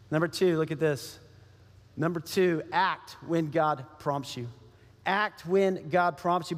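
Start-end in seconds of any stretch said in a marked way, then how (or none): none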